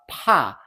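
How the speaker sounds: "pa" falling sharply after a strong puff on p; aspirated fourth-tone "pa". Shown as a demonstration. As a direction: puff the p, then drop "pa" fast. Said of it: "sharp falling tone and a strong puff".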